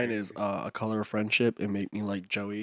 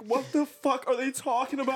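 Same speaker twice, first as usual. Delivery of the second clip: mock confused voice; 13 semitones higher than usual. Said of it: "Joey was like".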